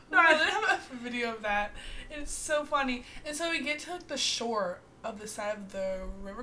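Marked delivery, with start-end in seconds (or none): none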